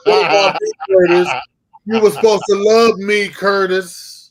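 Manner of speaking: high, loud wailing plea; hammed-up heartbroken diva crying out; mock diva plea. Friend playing the jilted diva, loud mock plea.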